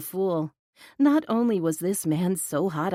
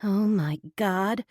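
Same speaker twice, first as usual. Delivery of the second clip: plain reading voice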